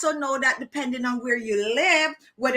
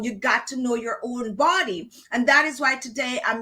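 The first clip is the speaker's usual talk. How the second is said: ordinary talk, nothing marked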